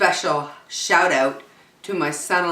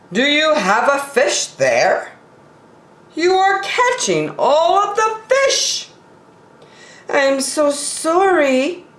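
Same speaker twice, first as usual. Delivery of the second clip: apologetic polar bear, much higher voice; a sorry polar bear pitched well up; 11 semitones higher than usual. Acted. Polar bear caught on the line apologizes, pitched high.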